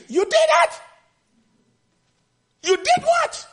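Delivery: high, incredulous outburst; shocked disbelief. Retold or imitated. David hearing the man killed Saul, shocked.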